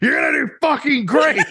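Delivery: cheering encouragement. Retold or imitated. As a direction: cheer her on, laughing. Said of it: "She's gonna be like".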